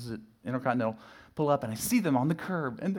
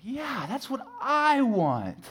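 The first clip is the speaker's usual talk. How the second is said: Eve's greedy want; 13 semitones higher, 5 dB louder, much slower than usual. Retold or imitated. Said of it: "It's like".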